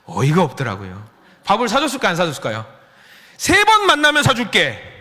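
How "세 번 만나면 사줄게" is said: high loud teasing bargain; playful promise. Retold or imitated. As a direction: bargain with the kids cheekily. Pastor answers kids' request.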